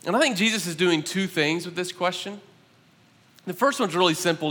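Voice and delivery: normal talking voice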